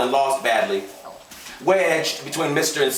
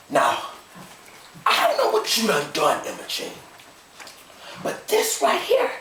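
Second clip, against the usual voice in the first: stern husband; 8 semitones higher than usual. A narrator's reading. Gus confronting his wife.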